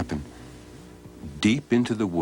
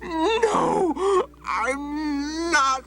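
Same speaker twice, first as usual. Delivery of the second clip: very high, choked cry of rage; strangled fury; much slower than usual. Acted. Old man denies anger while choking on it, very high and strangled. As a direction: squeeze it out near tears of anger.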